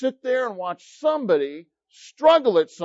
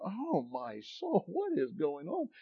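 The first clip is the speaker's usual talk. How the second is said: awed murmur; 12 dB quieter than usual. Retold or imitated. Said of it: "probably going".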